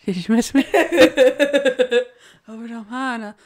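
retold stage lines swallowed by giggles; botched stage lines through laughter. Retelling the sold-out show night, laughing through mimicked lines. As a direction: imitate a struggling actor while cracking up. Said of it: "of course, on show night".

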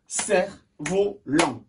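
"Cerf-volant" slowly cut in three; syllable by syllable. Shown as a demonstration. Teacher repeats the word after counting, syllable by syllable. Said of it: "Trois syllabes".

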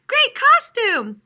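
high, loud and slow praise; impressed kids. A narrator's reading. Kids admiring turtle's costume, high and loud. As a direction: gush over the costume. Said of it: "called the kids".

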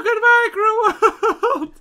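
hammed-up doomed hero, slow and loud; dramatic farewell. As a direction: wail it like a melodramatic death. Falling to doom in game.

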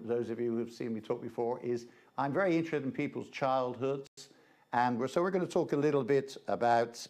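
ordinary talk, nothing marked

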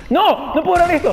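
high-pitched cry of disbelief; dismayed exclamation. Real, not acted. Exclaims at events in game. (exclamación aguda de incredulidad)

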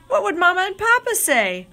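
ordinary delivery, nothing marked

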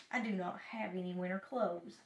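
low, soft grumbling; grumpy excuse. A narrator's reading. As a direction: make a sulky excuse, low and quiet. Toad grumbles from bed.

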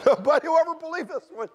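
high, loud, laughing squeal; real laughter lifting the voice way up; laughing disbelief. Real, not acted. Laughing at his unbelievable story.

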